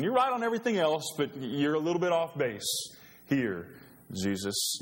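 cocky friend brushing off the warning; Peter piping up. Acted. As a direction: Peter boasting to Jesus that he'll stand firm. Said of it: "he pipes up and says".

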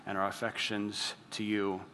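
soft, low-key closing prayer; hushed pastor's prayer voice. Acted. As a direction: pray it gently to God. Closing prayer before sermon.

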